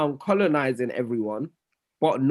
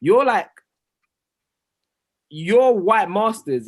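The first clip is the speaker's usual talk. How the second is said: emphatic; 6 semitones higher, 6 dB louder, slower than usual. Real, not acted. Heated point, loud.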